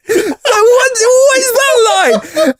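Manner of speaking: high, slow laughing shout. Real, not acted. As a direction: squeal it out through laughter. Laughing outburst while recalling.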